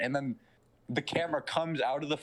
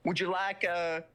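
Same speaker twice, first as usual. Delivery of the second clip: a lightly voiced Southern gent, higher; Southern devil voice; 6 semitones higher than usual. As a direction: offer it with sly Southern charm. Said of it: "You're really good at this voice".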